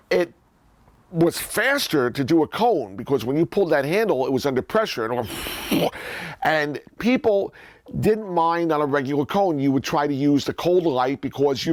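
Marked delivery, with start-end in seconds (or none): 5.3-6.4 short airy machine whoosh; whooshing pump noise. Retold or imitated. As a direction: imitate a burst of pressurized air. Imitates pressurized air pump dispensing.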